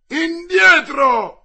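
long, high, full-force shout; yelled command. A narrator's reading. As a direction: roar the order to get back, drawn out. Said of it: "urlò D'Artagnan".

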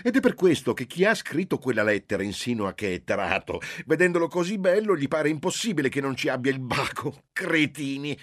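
high, loud, scornful mother's voice; indignant scorn. Acted. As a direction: spit out the final insult. Mother scorns the letter writer. (madre indignata contro l'autore della lettera, sprezzante)

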